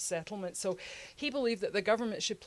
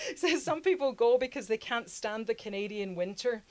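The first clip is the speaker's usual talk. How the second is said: reading it out through amused laughter; amused chuckling read, a bit higher; laughing; 3 semitones higher, 5 dB louder than usual. Real, not acted. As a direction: laugh in disbelief as you read it. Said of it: "oh, he actually says that".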